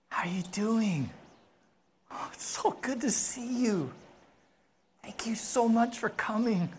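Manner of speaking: soft, tender, hushed greeting; gentle, intimate near-whisper of thanks; warm welcoming king. Acted. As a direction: welcome a dear guest quietly and gratefully. King greets guest at the table.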